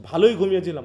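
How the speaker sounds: banging the floor. Acted. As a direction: thump the floor and insist. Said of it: "হাত দিয়ে আঘাত করে বলছে".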